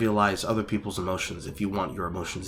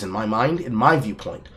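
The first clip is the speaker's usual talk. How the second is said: ordinary delivery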